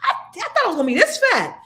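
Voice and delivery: high, laughing relief; giddy laughing relief at the scale; laughing surprise. Retold or imitated. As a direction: squeal it out through laughter. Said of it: "pleasantly surprised".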